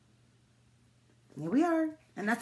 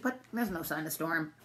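usual speaking voice

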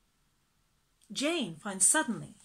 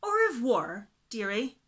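shriek; 2 semitones higher, 8 dB louder than usual. Acted. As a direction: call back shrilly from afar. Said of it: "shrieked Missus Brill".

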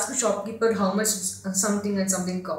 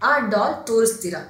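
fast; 5 dB louder, much faster than usual. Shown as a demonstration. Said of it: "you can say".

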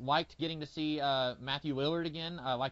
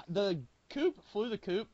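quoting a line; 6 semitones higher than usual. Retold or imitated.

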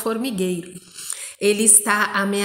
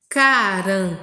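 emphatic teacher's dictation; syllable by syllable; 3 dB louder than usual. Shown as a demonstration. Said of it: "que tá escrito aí de verdinho".

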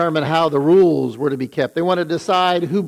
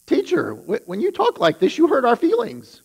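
high, complaining mock voice climbing higher; mock a hurt scribe, whining up the scale; whiny scribe; 10 semitones higher than usual. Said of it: "one of the scribes stands up and says".

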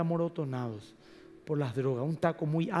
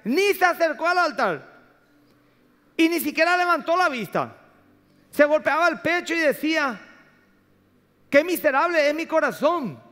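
loud, high, stretched-out pulpit delivery; full-force preaching; 10 semitones higher, 7 dB louder than usual. Real, not acted. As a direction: preach it at the top of your voice.

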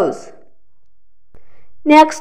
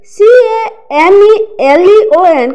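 spelling out letters; 6 semitones higher than usual. Shown as a demonstration. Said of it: "next word है कैमलन".

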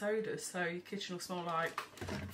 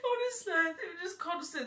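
laughing; 8 semitones higher than usual. Real, not acted. Laughing at her exhausting kids.

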